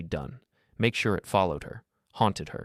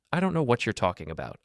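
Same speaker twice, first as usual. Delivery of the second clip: plain reading voice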